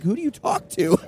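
high-pitched pitying question; mock concern. Fan worrying the singer is lonely, high mock concern. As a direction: imitate a deeply worried fan. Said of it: "Genuine concern".

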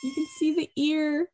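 usual speaking voice

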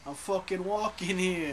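slow mock New Jersey accent, I'm walking here; tough-guy New Jersey drawl, lightly done. Friend asked for a Jersey accent, slow mock New Jersey voice. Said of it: "how you do a Jersey accent?".

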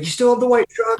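asking a high, eager question; eager fan question. Retold or imitated. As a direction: imitate a curious fan.

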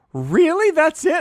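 high, loud disbelieving squeak; a kid who can't believe the price, way up high; shocked kid. Retold or imitated. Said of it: "I said".

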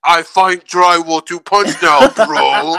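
slow, dopey gym bro; dumb bro voice. Pretend. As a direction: drawl it like a meathead bro. Mocking a bro stereotype.

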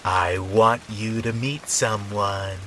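slow, lilting creepy neighbor; sing-songy. Acted. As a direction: sing it out slowly like a strange old woman. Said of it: "in a kind of sing-songy voice".

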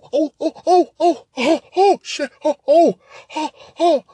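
mock pained moans. Imitating her pained moans.

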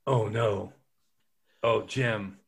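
ordinary talk, nothing marked

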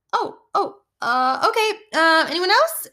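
high, halting, awkward recovery; flustered. Retold or imitated. Teacher reacting to a child's blurt, flustered and high. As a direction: stammer high, then move briskly on.

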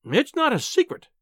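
high-pitched indignant cry; acted cry, pitched well up; cried out. A narrator's reading. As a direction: cry it out in protest. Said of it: "she cried".

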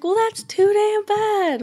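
put-on slow, high Southern old man; Southern accent. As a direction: drag it out in a bad Southern twang. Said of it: "really bad kind of Southern accent".

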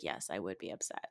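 meek reply. Retold or imitated. Mocking Heather giving in to Dana, meek and low. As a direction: mumble it like a pushover. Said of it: "She's like".

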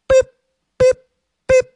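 short, high electronic beeps; high mouth-made beeps; imitated beeps. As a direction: beep like a machine, evenly spaced. Imitates beeps in segment jingle.

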